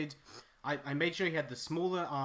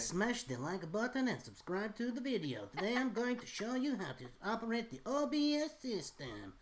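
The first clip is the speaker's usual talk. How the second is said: put-on high, hyped YouTube accent; new YouTube accent; 7 semitones higher than usual. Mocking YouTube tech tutorials, hyped YouTube accent. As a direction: sell it like a tech tutorial host. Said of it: "the new YouTube accent".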